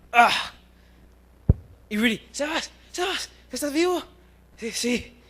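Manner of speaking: ordinary talk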